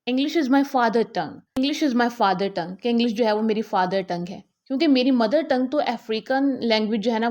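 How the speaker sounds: ordinary talk, nothing marked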